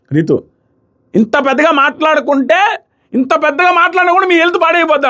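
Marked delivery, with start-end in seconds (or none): 1.1-5.1 imitating Ritu firing back at Madhuri, mock loud retort. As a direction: throw the jab back in a sneering put-on voice. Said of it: "అంటది పెద్దగా".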